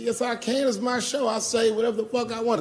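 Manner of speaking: high-pitched mock defiance; defiant retort. Acted quarrel with heckler.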